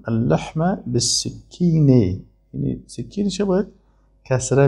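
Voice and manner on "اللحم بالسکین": slow model reading. Shown as a demonstration. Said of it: "مثلاً میگه".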